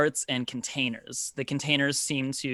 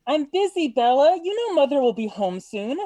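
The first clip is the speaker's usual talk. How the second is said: high, loud sister's voice from upstairs; raised, high-pitched brush-off called downstairs; calling down; 12 semitones higher, 6 dB louder than usual. Acted. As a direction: call down from a higher floor, too busy to come. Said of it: "another voice calls down from, from higher up".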